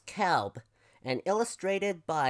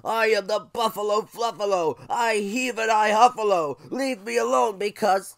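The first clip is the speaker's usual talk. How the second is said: loud, higher grumpy buffalo voice; snarling buffalo; 6 semitones higher, 9 dB louder than usual. Acted. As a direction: bellow it as a huffy buffalo. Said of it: "he snarled and he gnarled".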